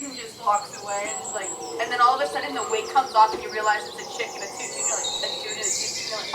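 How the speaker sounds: ordinary talk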